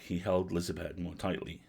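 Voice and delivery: ordinary delivery, nothing marked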